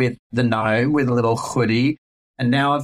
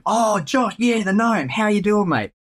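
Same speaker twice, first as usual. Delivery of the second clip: lightly voiced client, higher and bright with recognition; cheery client; 10 semitones higher than usual. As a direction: play the client who remembers the gnome guy. Client answering his callback.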